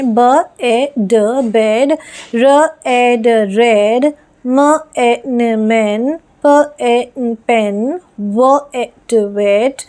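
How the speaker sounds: teacher sounding out letters then the word; sounding out phonics for a child; sound-by-sound blending demo. Shown as a demonstration. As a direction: spell each sound slowly, then blend the whole word. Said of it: "देखिए किस तरह से read करना है".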